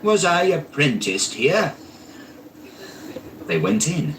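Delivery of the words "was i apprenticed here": astonished. A narrator's reading. Scrooge astonished at old warehouse.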